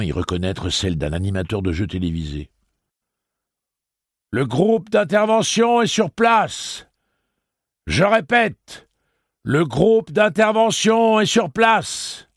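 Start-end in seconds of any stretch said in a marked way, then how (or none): 4.3-6.9 loud, slow megaphone announcement; police megaphone in the street; amplified voice. A narrator's reading. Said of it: "fit une voix amplifiée". 7.9-8.9 loud, slow megaphone announcement; police megaphone in the street; amplified voice. A narrator's reading. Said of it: "fit une voix amplifiée". 9.4-12.2 loud, slow megaphone repeat; police megaphone in the street; amplified repeat. A narrator's reading. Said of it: "fit une voix amplifiée".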